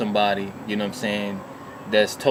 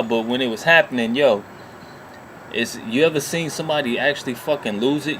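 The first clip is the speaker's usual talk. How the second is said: ordinary talk, nothing marked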